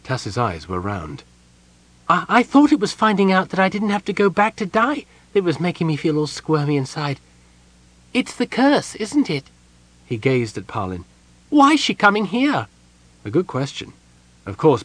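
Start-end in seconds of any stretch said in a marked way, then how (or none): 2.1-9.4 high kender voice, uneasy and squirmy; a nervous little kender guessing at the curse; high, uneasy kender worrying aloud; kender Tasselhoff. A narrator's reading. 11.5-12.7 high kender voice, uneasy and squirmy; a nervous little kender guessing at the curse; high, uneasy kender worrying aloud; kender Tasselhoff. A narrator's reading.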